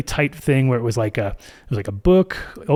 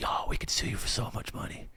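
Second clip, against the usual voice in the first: retold lawyer, whispered and much softer; menacing whisper; 4 semitones lower, 14 dB quieter than usual. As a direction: whisper the threat like a sly lawyer. Lawyers threatening to sue.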